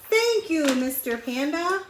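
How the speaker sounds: high loud cry of thanks; yelling it out. A narrator's reading. Lemur, thrilled, yells his thanks to Panda loudly. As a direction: shout the thanks with joy. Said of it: "yelling it out".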